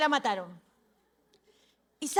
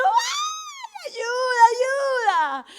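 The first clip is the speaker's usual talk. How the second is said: chillido muy agudo que pide auxilio; grito imitado; 10 semitones higher, 5 dB louder, much slower than usual. Retold or imitated. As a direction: chilla como una hermana aterrada, exagerando. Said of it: "Estaba gritando". (grito agudo de socorro, actuado)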